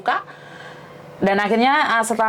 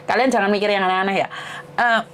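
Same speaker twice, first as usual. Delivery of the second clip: ordinary talk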